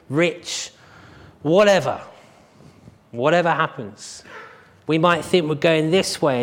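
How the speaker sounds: building pulpit call not to give up; fervent preaching. Acted. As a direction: lift the congregation with a stirring appeal. Closing exhortation.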